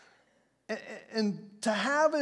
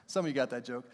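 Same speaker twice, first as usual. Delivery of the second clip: ordinary talk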